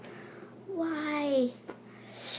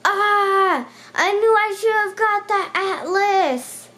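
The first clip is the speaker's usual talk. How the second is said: frustrated self-scolding; 6 semitones higher, 4 dB louder, slower than usual. Real, not acted. Regrets skipping atlas, exclaims.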